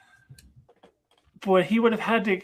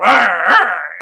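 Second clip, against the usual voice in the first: mock zombie shriek; 12 dB louder than usual. Zombie Gamora goes.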